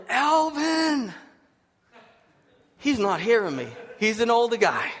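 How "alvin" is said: high, drawn-out call of a name; yelling. Retold or imitated. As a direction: yell it out to someone who isn't hearing. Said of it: "so I'm, I'm yelling".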